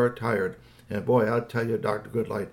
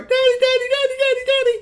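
put-on very high, loud child squeal; excited little boy; 9 dB louder than usual. A narrator's reading. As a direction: call for Daddy in a piercing kid voice. Littler boy runs to father.

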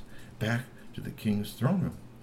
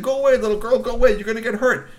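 high, loud shooing; warning shout; 12 semitones higher, 8 dB louder, faster than usual. Acted. Teen trolls warn the little girl, loud and high. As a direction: bark them away.

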